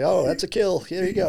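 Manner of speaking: high, loud referee call; mock referee. Acting out imaginary downrange referees.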